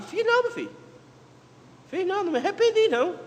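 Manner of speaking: high, quick mock-indignant voice of God; pitched well up, brushing the doubt aside; God answering. Preacher voicing God, scoffing at the people's doubt, high and brisk.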